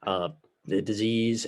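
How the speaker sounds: ordinary talk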